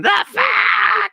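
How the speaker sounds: loud, high mock rock scream; imitated scream. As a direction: scream it out like the singer. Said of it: "he just kept screaming".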